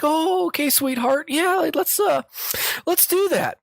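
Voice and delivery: put-on high-pitched little old lady from the South fooling a scammer; Southern old lady. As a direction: play a doddering Southern grandma on the phone. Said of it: "sounding kind of like a Southern old lady".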